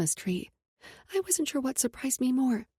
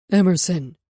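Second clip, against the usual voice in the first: deep male voice; 3 semitones lower, 9 dB louder than usual. Acted. Nate calls through door.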